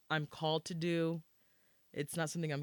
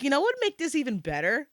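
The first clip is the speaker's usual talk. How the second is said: mocking own idea; 6 semitones higher, 6 dB louder than usual. Mocking her own past idea.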